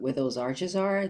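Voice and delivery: ordinary talk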